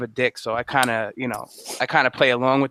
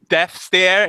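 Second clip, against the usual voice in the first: ordinary talk, nothing marked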